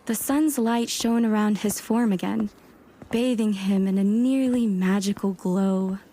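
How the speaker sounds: ordinary talk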